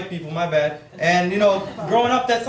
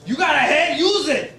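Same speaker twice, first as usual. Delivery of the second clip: higher-pitched exasperated father impression; scolding dad; 5 semitones higher than usual. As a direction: tell him off as dad.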